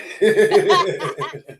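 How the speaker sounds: loud high laugh; loud laughter at the Wonder Woman joke; big laugh. Real, not acted. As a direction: burst out laughing.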